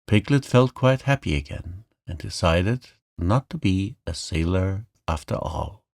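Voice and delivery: ordinary delivery, nothing marked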